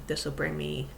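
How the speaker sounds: usual speaking voice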